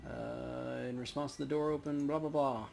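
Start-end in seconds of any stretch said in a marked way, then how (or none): none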